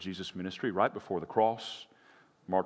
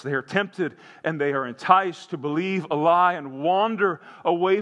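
higher, louder impassioned sermon; full-force preaching; 6 semitones higher, 5 dB louder than usual. Real, not acted. Pastor driving the point home to the congregation, impassioned. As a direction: preach it hard to the church.

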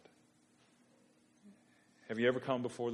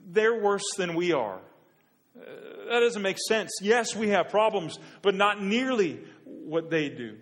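rising, loud and exasperated, pitched higher; frustrated outcry; 6 semitones higher, 8 dB louder than usual. Retold or imitated. Habakkuk questioning God: louder, higher, exasperated protest. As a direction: plead with God in mounting disbelief. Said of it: "In short, what he's saying is".

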